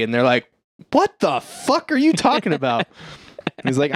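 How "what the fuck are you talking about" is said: high-pitched mock disbelief; hammed-up incredulous question. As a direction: imitate a baffled cop, voice jumping up. Cops baffled by confession.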